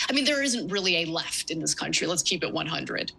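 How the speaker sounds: ordinary delivery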